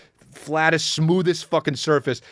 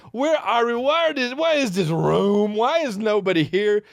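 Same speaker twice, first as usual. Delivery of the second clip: put-on dazed baby voice; 5 semitones higher than usual. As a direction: lightly voice the baby, lost.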